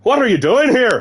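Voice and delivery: hammed-up Irish accent; bad Irish accent. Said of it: "I can't even do this accent".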